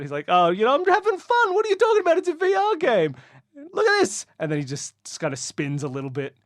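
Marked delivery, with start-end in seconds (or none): 0.3-3.1 high, loud protest of having fun; carefree player brushing off the teasing, high and loud; happy gamer. Acted. Josh to his teasing friends, happy and loud. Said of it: "he's pretty happy about it". 3.7-4.3 high, loud showing off; happy gamer. Acted. Josh showing friends his silly move, happy and loud. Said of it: "he's pretty happy about it".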